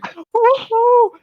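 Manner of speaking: imitated high, gleeful whoop; excited whoop. Friend mimicking rider going into the slide, excited whoop. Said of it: "you were excited going in".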